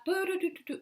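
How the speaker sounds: high, light singsong filler noise; carefree doo-doo ditty. Acted. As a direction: mime pottering along with a little tune. Mimes humming while putting on makeup.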